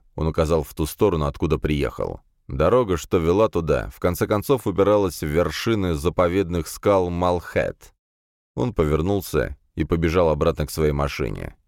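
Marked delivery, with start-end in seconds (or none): none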